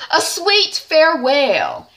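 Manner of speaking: high, slow, theatrical goodbye; melodramatic farewell. Acted. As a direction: play it like a soap-opera diva. Helen dramatically leaving Frank.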